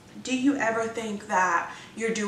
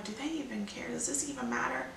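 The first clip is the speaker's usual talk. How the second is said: quiet muttered doubt; 4 semitones higher, 9 dB quieter than usual. Retold or imitated. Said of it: "I'm like".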